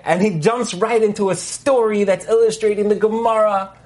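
ordinary talk, nothing marked

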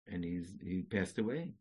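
usual speaking voice

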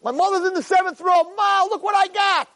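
high-pitched triumphant holler; acted winner's shout to mother; imitated scream. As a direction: call proudly up to the stands. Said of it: "That's what he's screaming".

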